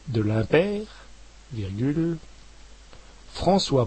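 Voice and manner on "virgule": ordinary delivery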